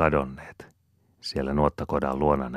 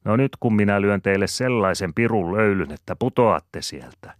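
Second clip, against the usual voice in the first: louder, high-pitched threat to the bathers; exclaiming; 6 semitones higher, 7 dB louder than usual. A narrator's reading. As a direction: call out a cheeky warning. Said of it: "Sanelma käkriäinen huudahtaa".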